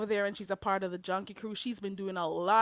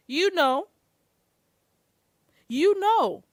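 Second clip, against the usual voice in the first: loud pointed insinuation; 9 semitones higher, 11 dB louder, slower than usual. Real, not acted.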